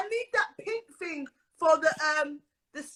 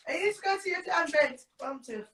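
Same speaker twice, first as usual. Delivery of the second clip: usual speaking voice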